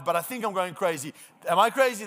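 ordinary delivery

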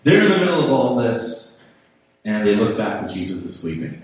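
ordinary delivery

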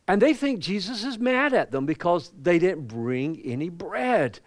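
preacher climbing into the story; fired-up preaching. Acted. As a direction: lift it into the pulpit voice. Preaching retells scene.

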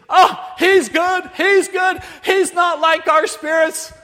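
high, loud, drawn-out excitement; amazed exclamation. Retold or imitated. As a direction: cry out an amazed discovery. Villagers' amazed discovery, acted.

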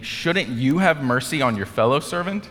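high, indignant rebuke, lightly acted; angry king. King rebuking the unforgiving servant, mildly acted indignation. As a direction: press the reproachful question upward. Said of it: "Then the angry king".

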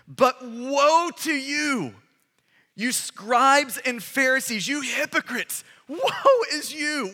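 drawn-out, weighty woe to you; slow emphatic woe. Acted. Preacher to the crowd, proclaiming woe slowly. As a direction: lean hard on each woe. Preacher reading Jesus' woes.

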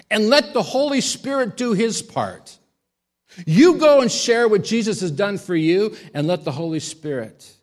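high, loud preaching call; forceful preaching. Acted. Preacher urging listeners to trust the Spirit, forceful. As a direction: drive it home to the congregation.

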